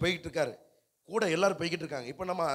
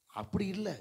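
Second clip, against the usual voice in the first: normal talking voice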